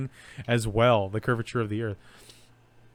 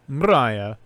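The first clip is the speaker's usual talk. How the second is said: put-on Russian accent; 6 dB louder than usual. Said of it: "Say that in a Russian accent".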